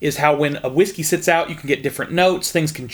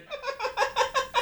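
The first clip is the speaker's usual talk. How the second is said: high laugh; 9 dB quieter than usual. Real, not acted. Laughing at own hillbilly bit.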